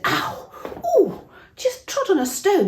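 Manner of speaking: high, loud surprised ouch; startled yelp. Acted. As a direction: yelp as if you stepped on something sharp. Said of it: "one of them said".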